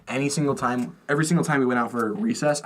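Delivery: ordinary delivery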